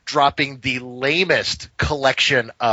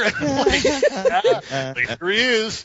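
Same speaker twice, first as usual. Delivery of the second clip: laughing; 9 semitones higher, slower than usual. Real, not acted. Laughing while retelling the catch.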